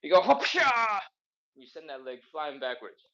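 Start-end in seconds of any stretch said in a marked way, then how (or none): 0.2-1.0 high, loud "hopsha" sound effect; whooshing exclamation. Shown as a demonstration. Coach demonstrating the flying leg kick, whooshing exclamation. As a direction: fling out a high, explosive kick noise.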